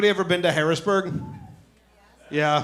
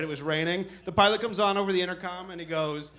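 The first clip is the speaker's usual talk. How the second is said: ordinary talk, nothing marked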